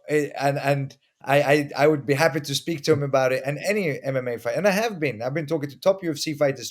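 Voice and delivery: ordinary talk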